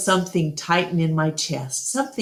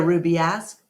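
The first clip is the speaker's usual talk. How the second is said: ordinary delivery, nothing marked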